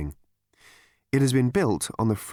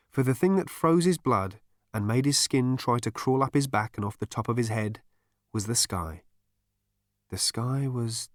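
plain reading voice

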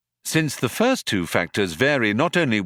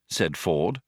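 ordinary delivery

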